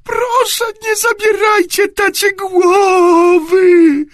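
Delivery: high, loud wailing plea; convulsive crying. A narrator's reading. Boy begging the headmaster, wailing in tears. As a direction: sob it out, long and high. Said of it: "wybuchł spazmatycznym płaczem".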